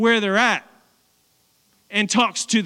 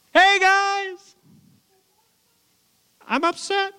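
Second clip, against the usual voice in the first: high, drawn-out mock complaint; mock upset; 11 semitones higher than usual. Preacher imitating apostles who tore their clothes, high mock upset. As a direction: feebly announce distress in a high put-on voice.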